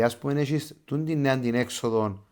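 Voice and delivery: ordinary talk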